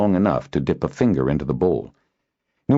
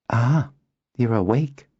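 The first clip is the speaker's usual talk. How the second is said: higher-pitched girl's greeting, lightly acted; lightly voiced visitor; 7 semitones higher than usual. Noella greets waking Kindon, lightly voiced.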